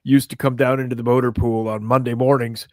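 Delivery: ordinary talk, nothing marked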